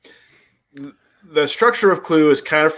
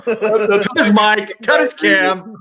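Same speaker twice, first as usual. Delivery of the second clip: laughing while joking; 7 semitones higher, slower than usual. Real, not acted. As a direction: giggle through the words.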